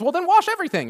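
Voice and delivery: jumps high, all in; eager to be washed head to toe; overeager Peter. Retold or imitated. Peter answering Jesus about the foot washing, high and eager.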